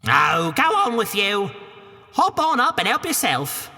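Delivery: put-on high Cockney woman shooing the boy; a high, brash Cockney housewife; Cockney ogre's wife. Wife shoos Jack from the castle door, high Cockney.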